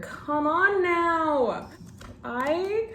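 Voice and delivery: high, slow, drawn-out exclamation; gushing excitement. Real, not acted. Vlogger admiring the manga cover, gushing. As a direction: draw it out high in sheer admiration.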